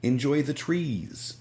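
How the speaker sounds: high, slow, chirpy mock well-wisher; mock cheery greeting. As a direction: toss it off like a cheesy greeting card. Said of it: "and they just say".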